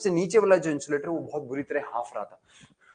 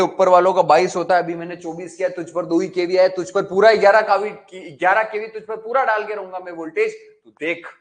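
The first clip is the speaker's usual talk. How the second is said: louder furious threat; angry threat; 4 semitones higher, 10 dB louder than usual. Acted. Mishra ji threatens the insulator, loud and angry. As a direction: bark it out, full of rage. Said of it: "Mishra जी एकदम गुस्से में बोले".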